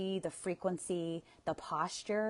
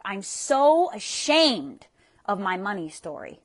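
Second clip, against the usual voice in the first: slow, higher, louder cry of shame; ashamed; 3 semitones higher, 11 dB louder than usual. Shown as a demonstration. Tapping coach voicing money shame, slow and pained. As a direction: stretch out "so" as if it hurts. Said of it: "maybe you wanna say something like".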